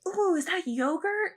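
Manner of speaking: put-on high little kid voice; little child. Small child asking at the craft table, high imitated kid voice. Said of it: "this little child runs up".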